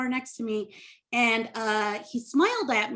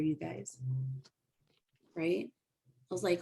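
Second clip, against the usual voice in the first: ordinary delivery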